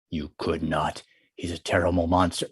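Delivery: low, quiet and scared; hushed, frightened. Acted. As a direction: warn in a fearful hush. Tiger frightened, much quieter.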